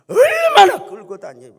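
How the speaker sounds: very high, loud, slow cry of exasperation; shouted grievance at the congregation; indignant outburst. Real, not acted. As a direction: let the complaint burst out at full force.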